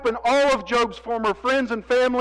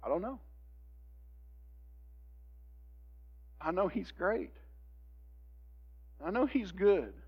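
quiet; 14 dB quieter than usual. Retold or imitated. Job answers his children quietly.

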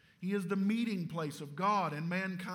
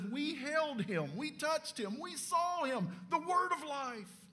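each phrase climbing much higher in pitch; awed exclamation; 8 semitones higher than usual. Acted. Preacher voicing John's awe at touching Jesus, climbing high. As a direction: build in wonder, line by line.

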